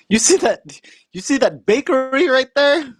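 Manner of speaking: high-pitched imitation of the uncle; proud uncle pointing out the town, voiced high.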